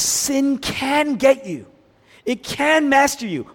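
high, drawn-out warning; pleading warning pitched well up; urgent warning. Acted. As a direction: warn him with stretched, emphatic words. God's warning to Cain, acted.

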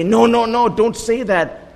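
high-pitched imitated protest, congregation begging him to stop; congregation pleading no. Priest's congregation begging him not to confess, high and pleading. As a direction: play the congregation waving him off, high and urgent. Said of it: "they say".

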